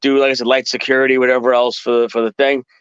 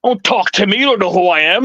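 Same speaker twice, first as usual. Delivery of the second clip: sudden arrogant rage; 8 semitones higher than usual. Retold or imitated. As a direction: flip into a haughty high-pitched snap. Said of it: "the A D D switch, just flipped".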